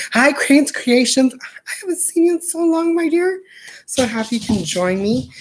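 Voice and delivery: high, sing-song welcome voice; high theatrical hello to a viewer; sing-song greeting. Acted. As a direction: greet her like a dear old friend. Greeting a viewer who joined.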